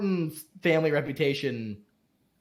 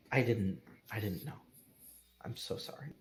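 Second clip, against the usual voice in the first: soft and low, fading into a whisper; stammered apology; 7 semitones lower, 11 dB quieter than usual. Acted. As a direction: stumble over the words, then whisper sorry.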